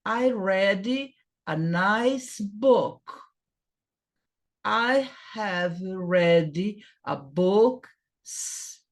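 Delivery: very slow, clear English for students; slow model sentences. Shown as a demonstration. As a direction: spell each word out slowly like a teacher. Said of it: "teacher models English sentences".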